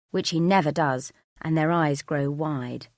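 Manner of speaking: ordinary delivery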